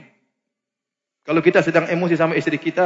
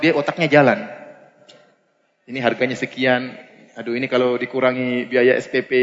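ordinary talk

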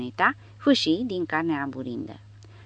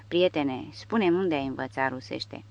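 ordinary delivery, nothing marked

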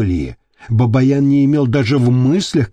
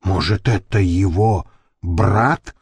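plain reading voice

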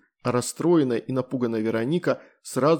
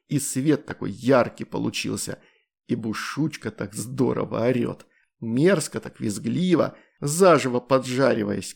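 ordinary delivery

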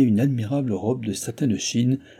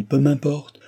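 louder, higher, dismissive outburst; exclaiming; 6 semitones higher, 5 dB louder, slower than usual. A narrator's reading. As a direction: wave the objection away forcefully. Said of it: "s'écria madame du Barry".

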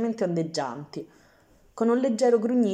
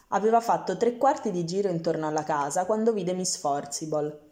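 plain reading voice

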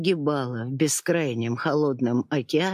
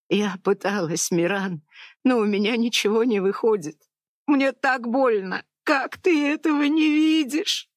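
much higher, tearful, fast; sobbing plea; 8 semitones higher than usual. A narrator's reading. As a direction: break down pleading. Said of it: "надрывно плакать". (надрывно плача, выше)

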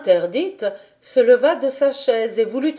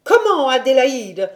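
loud, higher indignant cry; angry outburst; 4 semitones higher, 7 dB louder than usual. A narrator's reading. Governess explodes at Heidi after the meow, angry cry. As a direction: burst out in outrage. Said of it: "Alors la colère de la dame éclata".

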